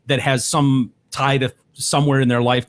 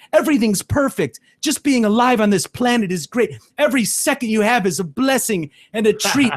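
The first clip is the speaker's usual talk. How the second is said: high-pitched, syrupy mock cheer; mocking gushy positivity; 8 semitones higher than usual. Viewer mimicking morning show host's outlook, mocking gushy positivity. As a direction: parody an over-grateful TV host, pitched up.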